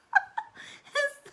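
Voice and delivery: real high-pitched burst of laughter; loud high laugh. Real, not acted. Laughing at own story.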